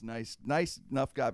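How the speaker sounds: ordinary delivery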